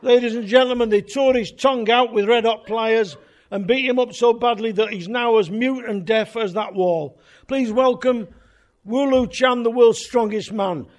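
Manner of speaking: loud, high, showy compere intro; compere's announcement. Retold or imitated. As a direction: belt it out like a club compere selling the act. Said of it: "introduced him as".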